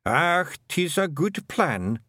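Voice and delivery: slow, simple Scots agreement; a daft wee man nodding along; dim Feegle. Acted. Daft Willie approves the plan, slow simple Scots.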